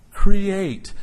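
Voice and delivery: a preacher spotlighting "Create"; stress on create. Shown as a demonstration. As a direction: lean hard on "Create" as one weighty word. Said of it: "Notice the word create".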